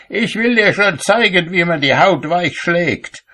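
high, sharp threat to an apprentice; threatening. A narrator's reading. Cobbler threatens his apprentice, high-pitched and menacing. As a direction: raise the pitch, threaten the lad.

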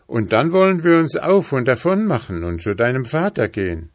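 a hint of a plucky lad; eager, determined boy. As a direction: urge her briskly to come away. Boy answers the princess.

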